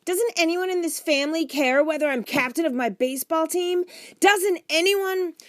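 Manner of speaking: put-on high, loud child's outburst; indignant protest. A narrator's reading. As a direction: demand that the family listen. Boy protests at being ignored.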